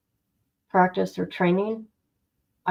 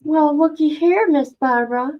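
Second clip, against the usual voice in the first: playful greeting; 7 semitones higher, 4 dB louder than usual. Real, not acted. Teasing greeting to a name on screen.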